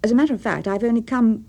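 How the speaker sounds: awkward, pressured delivery with a hitch; stammered out. A narrator's reading. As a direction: blurt it out, then falter before the end. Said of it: "stammered out".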